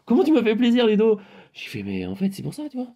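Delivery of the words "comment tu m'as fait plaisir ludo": excited. Retold or imitated. Exclaiming thanks to Ludo.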